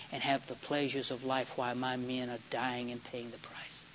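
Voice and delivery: low, slow and soft, with quiet resolve; measured, hushed retelling of a soldier's principled answer; solemn refusal. Retold or imitated. Soldier refusing his king's comforts, solemn.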